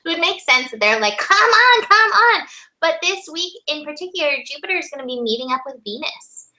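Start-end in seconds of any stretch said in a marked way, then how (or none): 1.2-2.5 higher, louder mock urging. Guides urging people to catch on, urging. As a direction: egg them on in a raised voice.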